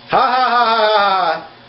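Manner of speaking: high, run-together ha-ha laugh; demonstrated laugh. Shown as a demonstration. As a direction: act out laughing with spelt-out ha-ha. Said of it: "He is laughing".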